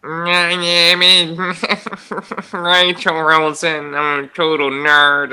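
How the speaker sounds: slow, halting geeky voice with laughs breaking in; nerd dweeb. Pretend. Put on the spot by her co-host, a slow nerd dweeb voice. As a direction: play a drawn-out, awkward nerd introducing himself. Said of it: "let's hear your nerd dweeb".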